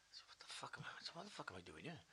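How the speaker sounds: very soft, half-whispered aside to himself; muttered thought. Retold or imitated. Storyteller voicing his bewildered thought on the plane, muttered. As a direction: mutter it under the breath.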